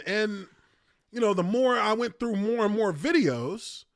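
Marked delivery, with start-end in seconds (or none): none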